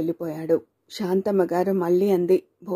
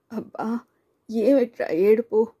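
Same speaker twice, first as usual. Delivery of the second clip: a touch of a quavering, tearful voice; holding back tears, voice shaking slightly; trembling voice; 3 semitones higher than usual. A narrator's reading. Young mother, worn out, pleads with crying baby, voice trembling. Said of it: "మీరా కంఠం వణికింది".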